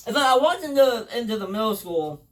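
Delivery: ordinary delivery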